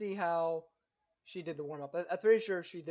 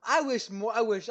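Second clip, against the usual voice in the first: usual speaking voice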